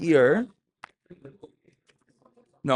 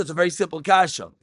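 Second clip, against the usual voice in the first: ordinary delivery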